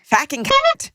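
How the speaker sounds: put-on high rough New Zealand accent; bad Kiwi accent, rising at the end. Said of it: "read that aloud in the worst Kiwi accent possible".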